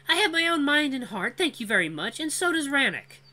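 high, defiant female voice; defiant young woman. Acted. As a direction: push back with pride. Cora brushes off Vul.

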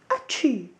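a big acted achoo; big sneeze. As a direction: throw out a big storybook sneeze. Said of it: "he gave a big sneeze".

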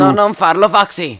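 high, loud begging; alarmed plea. Acted. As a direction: cry out to stop them. Pleading in armed standoff.

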